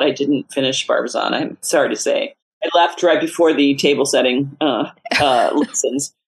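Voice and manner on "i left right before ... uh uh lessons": ordinary delivery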